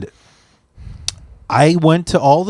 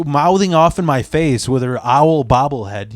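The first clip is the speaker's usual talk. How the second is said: normal talking voice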